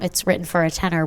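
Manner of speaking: ordinary talk, nothing marked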